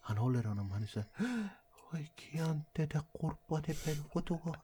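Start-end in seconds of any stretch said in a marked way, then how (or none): 1.9-4.6 higher and softer, cooing to a grandchild; imitating grandpa gently cooing at the baby; soft tender voice. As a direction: grandfather lovingly murmurs to his newborn grandson. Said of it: "han pratar så mjukt".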